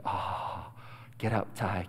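very low, hushed, nearly voiceless; drowsy self-talk. Retold or imitated. Sleepy boy urging himself up for pancakes, hushed. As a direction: murmur it softly as if half asleep.

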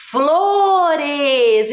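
FLORES stretched very long, both syllables held; sounding out. Shown as a demonstration. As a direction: read FLORES to children in slow, sustained syllables. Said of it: "O que escrevemos?". (Flores bem esticado, lendo devagar)

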